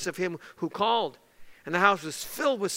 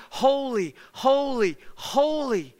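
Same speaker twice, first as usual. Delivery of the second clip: mildly raised call, each word set apart; angels calling out; 4 semitones higher, slower than usual. Retold or imitated. Preacher voicing angels in the temple, measured calling out. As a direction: call out each word as its own falling cry. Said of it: "And they called out".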